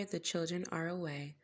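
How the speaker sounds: plain reading voice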